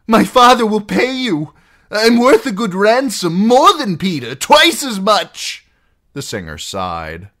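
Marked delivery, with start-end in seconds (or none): 0.1-5.6 high, loud bargaining plea; desperate pleading. Acted. Captive offering outlaws a ransom, desperate pleading. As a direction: plead at full volume for your life.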